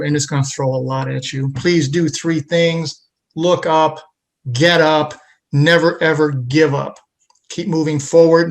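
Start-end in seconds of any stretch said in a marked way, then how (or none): 1.5-8.5 motivational host. Acted. Host's motivational closing to viewers.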